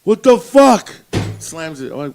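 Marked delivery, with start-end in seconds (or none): 0.0-0.9 loud, higher furious yell; angry shout. Retold or imitated. Retelling the angry friend storming in, shouting. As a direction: bark it like the pissed-off friend who just got a ticket. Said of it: "he's like".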